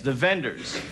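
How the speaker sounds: ordinary delivery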